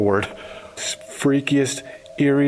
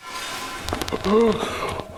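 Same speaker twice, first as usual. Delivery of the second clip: sharp gasp; 9 semitones higher than usual. Real, not acted. Startled by moving mannequin.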